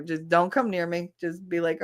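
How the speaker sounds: ordinary delivery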